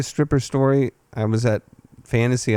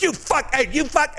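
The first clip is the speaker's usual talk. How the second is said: squeaky cursing; 13 semitones higher than usual. Retold or imitated. Stripper swearing at him.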